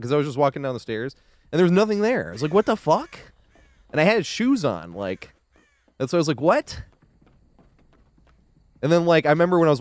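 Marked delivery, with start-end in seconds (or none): 2.5-3.2 high-pitched mock bafflement; retold disbelief. Recounting finding nothing on the stairs, in disbelief. 6.4-6.8 high, rising baffled question; retold incredulity. Recounting the injury despite wearing shoes, incredulous.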